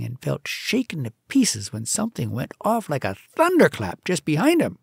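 plain reading voice

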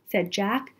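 usual speaking voice

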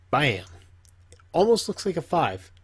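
normal talking voice

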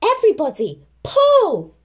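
high, loud call to pull; yelling. Acted. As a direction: yell the order to pull. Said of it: "Christopher Robin yelled".

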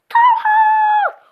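high, loud furious yell; shout. Pretend. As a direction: yell his name in outrage. Character shouts name.

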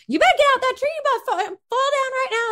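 bossy Southern neighbor voice; neighbor calling out. Acted. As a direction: holler up at a kid in a tree. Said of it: "called out by a neighbor real fast".

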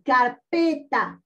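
"carpeta" slowly divided, car-PE-ta; syllable by syllable. Shown as a demonstration. Teacher giving an example word, "carpeta" slowly split by syllable. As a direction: say "carpeta" in separate beats, marking "pe". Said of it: "Cuando nosotros mencionamos carpeta".

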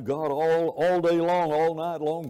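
ordinary delivery

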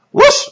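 very high, sharp whoosh; whoosh sound. Retold or imitated. Preacher mimicking running fast at the word fast, whoosh. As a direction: zip past like a runner.

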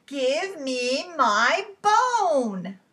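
loud, very slow spooky demand; drawn-out storybook holler. Acted. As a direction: boom it out, stretching each word. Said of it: "a teeny tiny bit louder than before".

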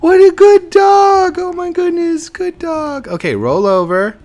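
put-on very high, loud doggy baby talk. Gamer praising puppy Code Red, very high baby talk. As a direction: coo over the puppy.

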